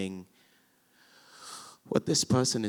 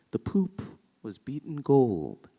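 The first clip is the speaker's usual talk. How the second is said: slow, higher sung-out verse; lyrical verse; 7 semitones higher, slower than usual. Shown as a demonstration. Reciting Enobarbus's barge speech, lyrical and slow. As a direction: savour the lines lyrically. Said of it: "it's lyrical".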